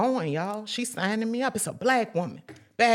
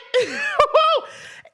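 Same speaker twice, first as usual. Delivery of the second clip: bright high burst of laughter; loud high laugh; 8 dB louder than usual. Real, not acted. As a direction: laugh at yourself after preaching. Laughs after shout.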